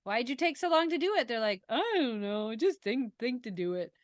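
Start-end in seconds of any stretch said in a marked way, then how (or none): 0.0-1.3 exasperated parent. Retold or imitated. Parent quoted questioning kids. 1.7-3.9 lightly voiced sulky teen; a hint of a mumbling teenager. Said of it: "Teenager voice".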